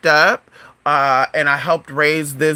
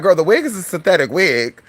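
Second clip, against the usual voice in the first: ordinary talk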